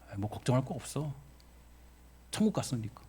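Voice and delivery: softly quoted, unworried reassurance; calm quote. Retold or imitated. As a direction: quote the bereaved believer quietly and simply. 유족의 담담한 고백을 인용. (담담하고 나직하게 옮기는 말투)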